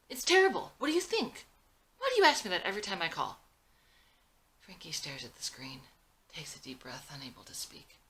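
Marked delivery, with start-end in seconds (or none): 0.1-3.4 high, loud, fast neurotic outburst; quick, urgent, exasperated. Acted. Exhausted mother in jail snapping at her daughter, fast and urgent. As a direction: snap back, frazzled and rushed. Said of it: "Speaks quickly and urgently".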